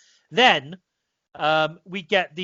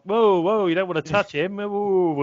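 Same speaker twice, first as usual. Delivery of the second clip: imitating [name] warning; 3 semitones higher than usual. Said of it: "it's like".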